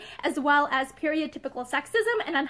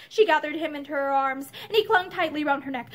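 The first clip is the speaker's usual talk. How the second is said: plain reading voice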